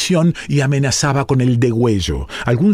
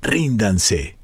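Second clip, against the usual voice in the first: usual speaking voice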